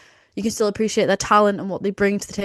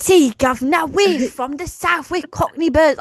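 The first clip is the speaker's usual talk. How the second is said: mock Cockney, higher and louder; 7 semitones higher, 4 dB louder than usual. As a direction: parody of the Brawling Birds in Cockney. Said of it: "And I'm just like".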